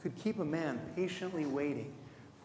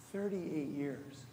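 slow and quieter, letting Thirty-eight years sink in; slow, soft repeat; 5 dB quieter, slower than usual. Shown as a demonstration. Preacher echoing the man's long wait, slow and hushed.

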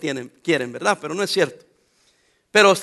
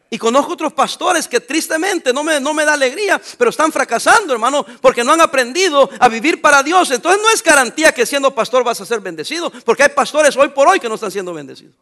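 sermón a pleno pulmón, más alto; predicación fervorosa; 5 semitones higher, 6 dB louder than usual. Acted. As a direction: predica con fuerza a la congregación. Preaching at full force. (predicando con fuerza)

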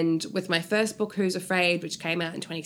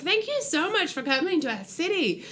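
grateful fan; 11 semitones higher than usual. Retold or imitated. Adelaide fans quoted gratefully.

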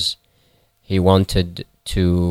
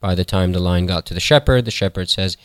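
usual speaking voice

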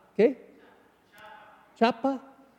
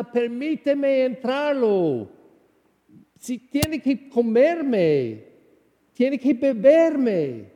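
higher, louder, slow pleading voice of Jesus; voicing Jesus; 5 semitones higher, 4 dB louder, slower than usual. Priest voicing Jesus to the congregation, slow fervent plea. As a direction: let Jesus ask slowly and fervently to be received.

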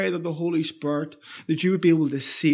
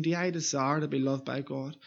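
listener's inner question; 5 semitones lower, 9 dB quieter than usual. Pretend. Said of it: "Are you asking yourself that question?".

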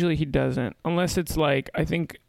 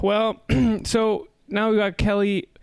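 ordinary talk, nothing marked

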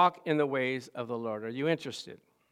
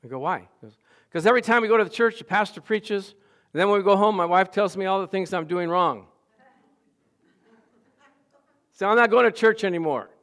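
lightly voiced complaining friend, raised and fast at first; quoting a friend; 4 semitones higher, 6 dB louder than usual. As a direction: play the fed-up husband, a bit higher. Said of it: "And he said".

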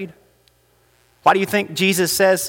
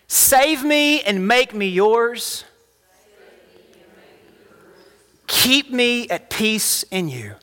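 slow, high, measured prayer for repeating; led prayer; 6 semitones higher, slower than usual. Acted. Pastor leading the congregation in prayer, slow and measured. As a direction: lead the room line by line in prayer.

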